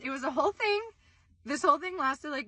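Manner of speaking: ordinary delivery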